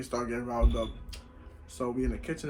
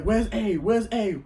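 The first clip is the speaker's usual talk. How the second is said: loud, raised-pitch demand; angry demand; 7 semitones higher, 7 dB louder, much slower than usual. Retold or imitated. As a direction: storm in hunting for someone. Sister storms in demanding A.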